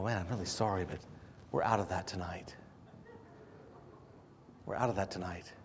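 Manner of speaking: apologetic waiter. Retold or imitated. Waiter telling a diner the dish is gone, hushed and sorry. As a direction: break the bad news gently and timidly.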